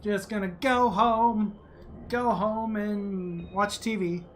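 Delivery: very slow, stretched-out words; lazy drawl. Pretend. Player deciding to skip work for TV, lazy drawl. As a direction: draw it out, in no hurry at all.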